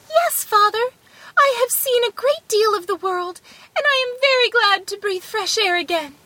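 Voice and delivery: high, piping little-man voice; little son. A narrator's reading. Tom Thumb to his father after rescue, high and glad. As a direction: answer gladly in a tiny high voice.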